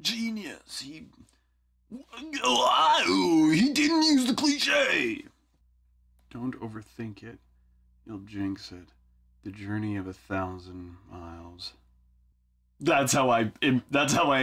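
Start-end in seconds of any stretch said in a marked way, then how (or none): 0.0-5.2 high, slow amazed listener; awed fan voice. Retold or imitated. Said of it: "people are like". 6.3-11.7 quiet murmur. Pretend. Mock song lyric murmured.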